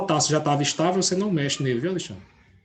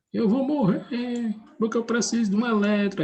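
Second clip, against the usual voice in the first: crying oxygen atom; 7 semitones higher than usual. Acted. Teacher voicing a desperate oxygen atom for the class, mock crying. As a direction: whine it out like a crying cartoon atom. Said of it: "Ele tá chorando, aperreado".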